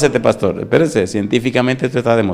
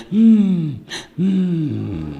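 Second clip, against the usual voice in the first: put-on soothing baby hum; 7 semitones higher than usual. Pastor imitating a baby calming in mother's arms, contented hum.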